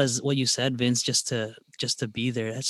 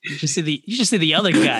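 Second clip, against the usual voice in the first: laughing; 4 semitones higher, 6 dB louder, faster than usual. Real, not acted. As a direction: joke said through a laugh, louder. Joking jab, laughing.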